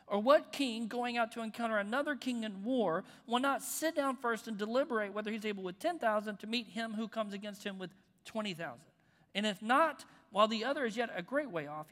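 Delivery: full-force sermon reading; preached scripture. Acted. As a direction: proclaim it to the room.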